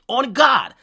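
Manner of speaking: mock oath; exclaiming. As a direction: call it out to the heavens. Swearing on God, calling out.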